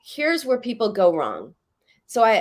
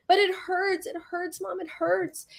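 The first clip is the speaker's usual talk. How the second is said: put-on higher, softer whimpering child; imitating a crying child; 10 semitones higher, 4 dB quieter than usual. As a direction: plead like a hurt little girl. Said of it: "She keeps crying and crying".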